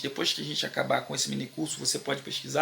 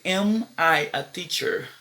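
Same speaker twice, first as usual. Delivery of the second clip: slow question model; slower than usual. Shown as a demonstration. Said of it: "vai ser".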